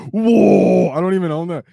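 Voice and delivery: loud, high, shocked whoa; surprised. Real, not acted. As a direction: react as if floored. Reacting to chat's huge order.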